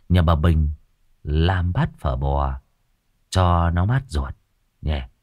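low, soft coaxing with giggles; sheepish wheedling lad. Acted. Tâm coaxes uncle to the pho shop, sheepish giggles. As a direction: sweet-talk uncle into breakfast.

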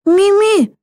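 high-pitched cry of a name; indignant shout. A narrator's reading. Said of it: "закричал Стив". (возмущённый крик высоким голосом)

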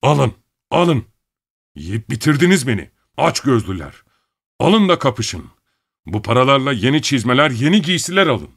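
trembling furious shout; shaking with anger. A narrator's reading. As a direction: rage so strong the voice trembles. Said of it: "Bedeni sinirden titriyordu".

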